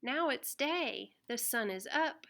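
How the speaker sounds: ordinary delivery, nothing marked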